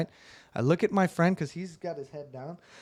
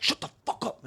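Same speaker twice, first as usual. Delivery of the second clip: retold angry outburst; 7 semitones higher, 5 dB quieter than usual.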